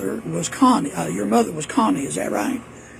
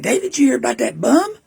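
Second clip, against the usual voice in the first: imitating mama; 6 semitones higher, 6 dB louder than usual. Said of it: "She said".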